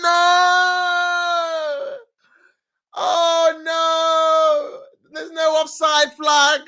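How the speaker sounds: high, loud disbelieving cry; stunned fan crying out; shocked shout. Real, not acted. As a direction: yell no at the screen. Disbelief at the goal.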